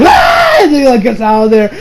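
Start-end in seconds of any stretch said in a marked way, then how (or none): 0.0-0.6 very high startled yelp; mock scream. Goku opening eyes in lingerie store, high yelp. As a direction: shriek in shock. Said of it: "He's like".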